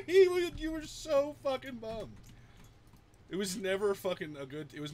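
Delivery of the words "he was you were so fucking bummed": high, loud, laughing squeal; words squeezed out through big real laughter; laughing hard. Real, not acted. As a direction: crack up while talking. Cracking up at the memory.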